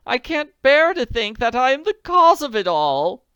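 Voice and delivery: wringing her hands in distress; agitated society lady. Acted. Agitated lament before sobbing.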